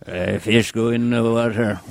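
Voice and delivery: put-on slow Quint sea captain accent; gruff old sea captain drawl; Robert Shaw impression. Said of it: "What if Scarface met Robert Shaw".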